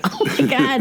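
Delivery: high startled gasp of alarm; gasped oh my God. Retold or imitated. Telling Todd how tense the movie made her, high startled cry. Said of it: "I'm like".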